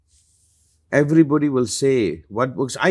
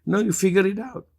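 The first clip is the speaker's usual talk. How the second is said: teasing master; 5 semitones higher than usual. Pretend. Teasing reply playing the master.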